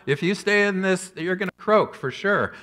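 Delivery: loud mock warning shout; yelling at the frog. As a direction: yell it at the frog like a scolding therapist. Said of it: "yell at this frog".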